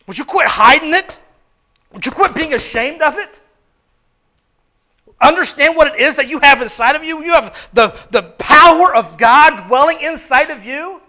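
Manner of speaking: fervent pulpit exhortation; rising preacher appeal. Acted. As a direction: urge them on, building.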